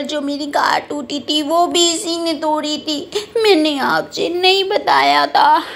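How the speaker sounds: put-on tearful little-kid tattling; complaining child. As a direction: whine to mama as a wronged child. Child figure complains to mother.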